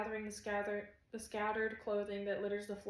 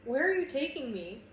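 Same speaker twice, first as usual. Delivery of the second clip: raised, higher exclaimed question; mildly alarmed, loud query; breathless alarm; 4 semitones higher, 7 dB louder than usual. A narrator's reading. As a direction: call out in startled protest. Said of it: "I'm breathless and gripping".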